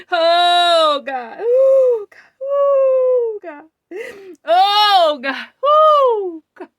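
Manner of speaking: loud, high sobbing wails; crying out to God. Real, not acted. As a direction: cry out in overwhelmed worship, weeping. Overwhelmed reliving the prophecy.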